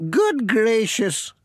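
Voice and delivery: put-on high, slow old lady voice; fussing, grateful grandmother; Granny Dryden's voice. Old lady thanking the postman for chasing her washing, high and fussing. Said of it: "said Granny Dryden".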